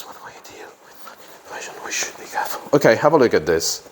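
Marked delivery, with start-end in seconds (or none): 0.0-2.6 barely audible whisper; whispering to self. Real, not acted. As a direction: whisper it under your breath. Murmurs sentence while preparing it.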